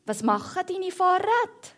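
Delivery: high-pitched called question; lightly acted storybook call. A narrator's reading. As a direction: call the question over as the mice. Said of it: "rufen sie".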